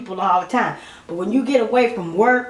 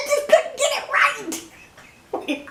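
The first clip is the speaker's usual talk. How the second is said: high, slow words squeezed through laughter; laughing at her own slip; cracking up; much slower than usual. Real, not acted. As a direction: lose it laughing mid-sentence. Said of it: "I cracked up at myself".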